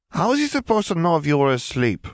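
high-pitched, exasperated challenge; indignant sisterly scolding, pitched high; exasperated younger sister. A narrator's reading. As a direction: argue back in a high voice. Gabby argues back in quarrel.

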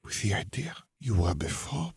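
soft whispered words. A narrator's reading. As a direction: keep it under the breath. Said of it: "Fleur whispered".

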